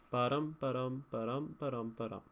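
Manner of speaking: five even "Ba-dum" pairs, stress on "dum"; iambic pentameter. Shown as a demonstration. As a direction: count ten beats as "ba-dum". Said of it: "That's ten".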